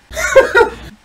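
shrieking laugh; high burst of laughter. Real, not acted. Husband cracking up at his own bit, loud and high.

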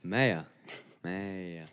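"Meier" said low and soft, falling at the end; intonation demo. Shown as a demonstration. Showing friends how tone changes the word, low falling "Meier". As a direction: let "Meier" drop low and quiet. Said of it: "Es kommt nur auf die Betonung an".

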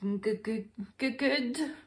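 nervous stuttered start; stammering. A narrator's reading. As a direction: stammer on the first sound. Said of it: "the bear stammered".

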